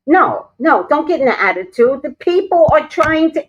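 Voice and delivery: loud, high-pitched scolding; scolding the cat. Real, not acted. Woman scolding her cat on stream, loud and high. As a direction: tell the cat off sharply.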